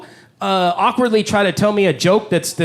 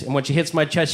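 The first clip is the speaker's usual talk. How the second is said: ordinary talk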